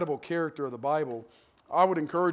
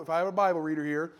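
usual speaking voice

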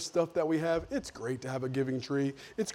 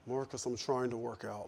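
weary aside; 3 semitones lower, 9 dB quieter than usual. Retold or imitated. Annoyed reply.

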